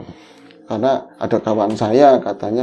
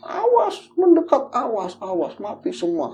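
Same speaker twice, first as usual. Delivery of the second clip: threatening; 8 semitones higher than usual. Retold or imitated. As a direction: act out a menacing warning. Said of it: "Kayak mau bunuh diri kayak gitu. Ngancem-ngancem".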